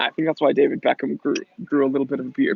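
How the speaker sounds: ordinary talk, nothing marked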